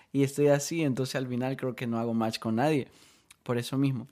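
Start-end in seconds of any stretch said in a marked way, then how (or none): none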